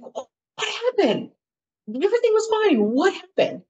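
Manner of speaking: very high, bewildered questioning; alarmed disbelief. Retold or imitated. Boyfriend to crying girlfriend, alarmed disbelief. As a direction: imitate a shocked boyfriend, pitch shooting up. Boyfriend sees her bawling, asks.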